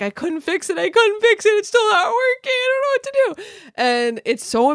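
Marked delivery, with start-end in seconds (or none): 0.0-0.8 whiny panic. Retold or imitated. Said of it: "I was like". 0.8-1.5 louder whiny panic. Retold or imitated. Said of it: "I was like". 1.5-3.7 high, fast, frantic whimper; mock panicked whine. Guest panicking to the family over the broken Wi-Fi, a high whine. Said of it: "I was like".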